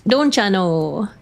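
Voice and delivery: slow, drawn-out Minnesota lilt; put-on Minnesota accent, slow. Shown as a demonstration. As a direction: stretch it out like a folksy Minnesotan accent. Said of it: "I know they say that in Minnesota".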